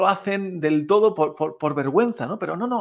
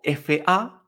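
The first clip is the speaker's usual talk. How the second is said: "fa" drawn out and rising; rising second tone. Shown as a demonstration. Teacher giving another example, "fa" rising slowly. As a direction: stretch "fa" upward like a question. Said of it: "siempre es ascendente el sonido".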